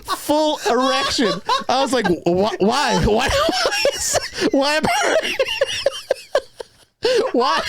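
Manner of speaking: high, slow words squeezed out through laughter; can barely get it out for laughing; real helpless laughter; laughing hard. Real, not acted. Cracking up telling dream.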